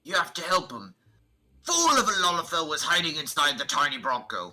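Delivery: high, loud character voice; urgent game character. Acted. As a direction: cry it out in a hammed-up character voice.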